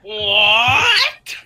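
high, drawn-out questioning yelp; hammed-up amazed reaction; stunned disbelief. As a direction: stretch it upward in astonishment. Acts stunned at the twist.

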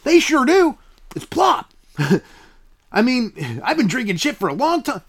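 high, beaming salesman voice in a skit; pushy pitchman. Acted. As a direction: sell it like a cheesy TV ad guy. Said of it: "I got a commercial coming up".

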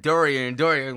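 loud repeated Dorian, falling; calling out name. Retold or imitated. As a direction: call Dorian twice loudly. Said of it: "Kept sounding like".

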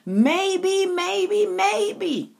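high, musing triple maybe; drawn-out maybes. Real, not acted. Musing to listeners on what might have been, high and drawn out. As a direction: let each maybe float up high then fall.

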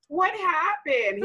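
high, drawn-out lament; mock dismayed mom. Mom asking her grown son what happened, mock dismayed. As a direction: stretch it out slowly like a wounded mother.

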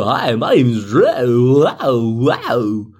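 slow, swooping goofy introduction; weird self-parody. Acted. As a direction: stretch the vowels into a silly, weird way of talking. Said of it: "I probably talk a weird way too".

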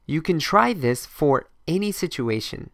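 usual speaking voice

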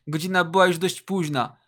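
ordinary delivery, nothing marked

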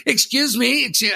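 very high insistent "excuse me"; mock mother. Imitating the mother repeating excuse me, very high and insistent. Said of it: "She goes".

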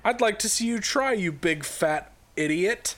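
high start, slowing into the insult; mock taunting dare. Dad dares the angry neighbour, mock taunting. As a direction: goad him in a hammed-up quarrel. Acted taunting between feuding dads.

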